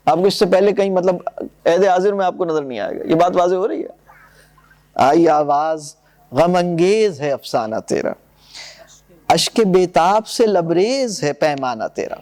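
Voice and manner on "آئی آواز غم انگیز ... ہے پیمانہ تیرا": lower, measured verse reading; reading Iqbal's poem aloud to the class; poem recitation. Acted. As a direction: recite the couplets with weight.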